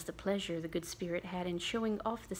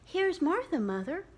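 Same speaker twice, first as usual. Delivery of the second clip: put-on higher, louder cheerful girl; lightly voiced girl calling in; a girl popping in, higher; girl announcing herself; 9 semitones higher, 5 dB louder than usual. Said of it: "said a girl".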